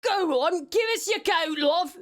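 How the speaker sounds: put-on cheeky British commoner accent; British peasant accent. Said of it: "I added the love in there".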